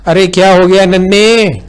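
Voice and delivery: high, loud call across the house. A narrator's reading. Mother calling out to her crying son from afar.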